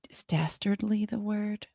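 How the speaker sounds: soft unvoiced whisper. Real, not acted. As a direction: breathe it as an aside. Unsure aside, unvoiced.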